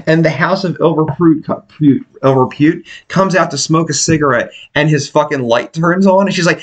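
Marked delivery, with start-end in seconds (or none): none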